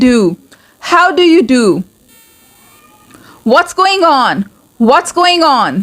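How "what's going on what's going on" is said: normal talking voice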